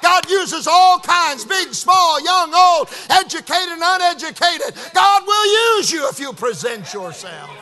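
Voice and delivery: high, loud preacher's exhortation; full-force preaching. Real, not acted. Telling the congregation God can use anyone, full-force preaching.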